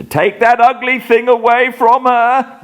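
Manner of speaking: high, fretful question; nervous need for reassurance; anxious pleading. Acted. Raving man questioning wife.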